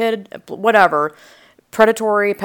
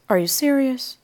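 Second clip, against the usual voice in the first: imitating an incredulous neighbour, pitched up; earnest, raised-pitch questioning; dead serious; 7 semitones higher than usual. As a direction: ask it in earnest disbelief, higher. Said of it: "The way she said it".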